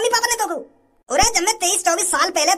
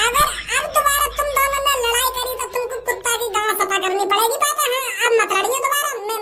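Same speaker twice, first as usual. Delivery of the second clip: put-on higher little-boy voice scolding; child voice; 4 semitones higher than usual. Son scolding his fighting parents, higher childish voice. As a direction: play a preachy kid.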